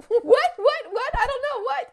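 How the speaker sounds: very high, laughing squeal of confusion; flustered mock panic. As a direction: replay being caught out, pitched sky-high. Flustered reply to the teacher.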